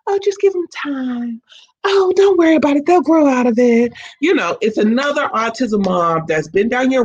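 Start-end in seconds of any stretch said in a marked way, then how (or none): 0.1-3.9 condescending. Retold or imitated. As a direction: brush off a worried parent, condescendingly. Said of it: "kinda condescending you saying".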